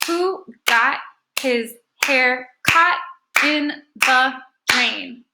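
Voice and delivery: very slow, each syllable set apart; deliberate syllable-by-syllable reading; clapped-out syllables. Shown as a demonstration. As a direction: clap out every syllable as you read. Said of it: "let's go ahead and clap it out".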